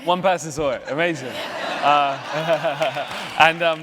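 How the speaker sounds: laughing. Real, not acted. Guest laughing at own obscure film.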